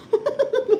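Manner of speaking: high burst of laughter; a sudden high giggle; laughing. Real, not acted. Laughing at her own misery.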